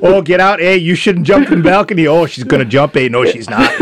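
put-on comic Canadian hoser accent; chatty mock-Canadian commentary with eh tags; Bob and Doug McKenzie. Hoser watching the balcony scene, mock Canadian accent. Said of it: "turning into Bob and Doug McKenzie".

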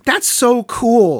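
slow, high and amazed; drawn out in wonder; a touch of childlike awe; awed. Retold or imitated. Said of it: "And I said".

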